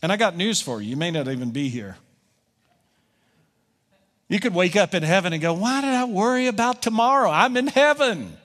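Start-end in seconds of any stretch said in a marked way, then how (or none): 5.5-8.4 amazed realization. Pretend. Waking up surprised in heaven.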